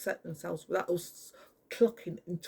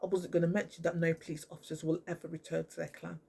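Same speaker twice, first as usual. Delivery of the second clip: ordinary delivery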